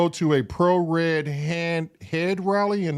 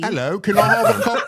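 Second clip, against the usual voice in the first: put-on British accent; 4 dB louder than usual. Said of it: "Can you do your best British accent".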